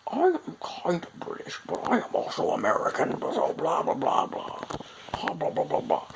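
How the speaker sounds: put-on British-American old-movie accent; mock mid-Atlantic blend, trailing into blahs. Vlogger mimicking Citizen Kane to show the weird accent, hammed-up mid-Atlantic. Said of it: "weird British American meld of accent".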